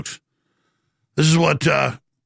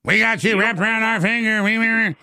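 high mocking voice; high mocking imitation of an arrogant leader; 7 semitones higher than usual. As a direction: sneer it out, pitched up. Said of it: "they're actually talking like that".

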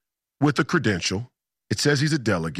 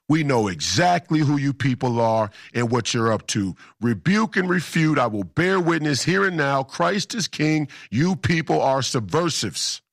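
retold screaming; 3 semitones higher than usual. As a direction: hammer out a mock-screamed rebuke. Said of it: "I'm just screaming in his face".

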